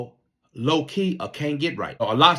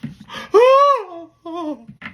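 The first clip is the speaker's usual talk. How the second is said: high laughing gasp; 5 dB louder than usual. Real, not acted. Laughing at crying recruit.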